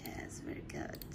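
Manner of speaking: soft whispered praise. Real, not acted. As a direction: breathe it out, barely voiced.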